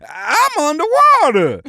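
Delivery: loud, high Southern cartoon character; Sandy Cheeks. Cartoon newcomer finding herself underwater, loud high character voice. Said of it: "gotta get my higher register".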